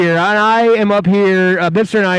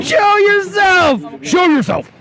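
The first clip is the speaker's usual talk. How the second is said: playful shout; 12 semitones higher, slower than usual. Real, not acted. Urging friend onto camera.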